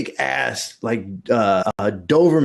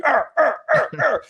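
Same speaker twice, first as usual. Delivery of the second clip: mock dog growl; 13 semitones higher than usual. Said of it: "he's like".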